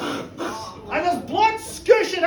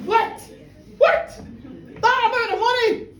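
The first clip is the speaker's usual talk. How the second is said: indignant shock; shocked outrage; 7 semitones higher than usual. Retold or imitated. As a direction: splutter at the size of the bill. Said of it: "he goes".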